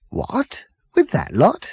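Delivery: incredulous Arthur. Acted. Arthur questioning the captain about frozen hairdressers, incredulous. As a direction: Arthur aghast at the news.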